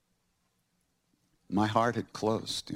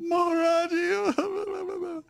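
drawn-out, very high and slow; long high cry of the name; much slower than usual. Retold or imitated. Overwhelmed disciple crying out the guru's name. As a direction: stretch the name up high in awe.

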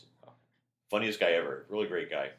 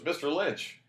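quoted greeting; 9 semitones higher, slower than usual. Retold or imitated. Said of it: "he goes".

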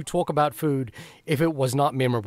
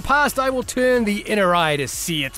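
dramatic recital; 3 semitones higher than usual. Acted. Litany recital over intro music.

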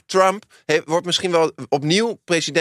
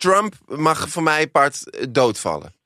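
slow, emphatic dismissal; much slower than usual. Real, not acted.

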